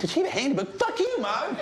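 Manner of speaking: very high cartoon boy voice; bratty cartoon boy yelling at mom; Cartman yelling. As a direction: spit it at his mom. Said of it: "'Cause he's like".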